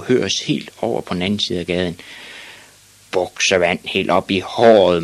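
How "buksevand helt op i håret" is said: ordinary delivery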